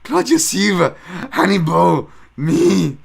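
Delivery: loud over-the-top Hannibal impression. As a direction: belt it out in Hannibal's own voice. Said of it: "Sorry, that's how he talks".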